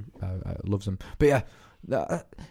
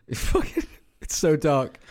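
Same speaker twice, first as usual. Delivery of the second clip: usual speaking voice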